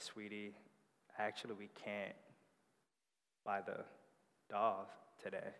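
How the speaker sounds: dad softly telling his daughter they can't buy the dolls; gentle letdown. Acted. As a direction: let her down gently. Dad breaks daughter's heart.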